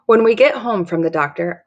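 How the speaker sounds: ordinary delivery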